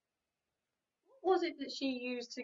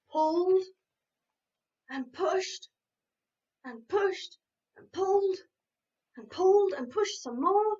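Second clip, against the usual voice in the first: louder, higher, heaving; straining effort; 6 semitones higher, 8 dB louder than usual. A narrator's reading. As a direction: push and pull with effort. Straining tug.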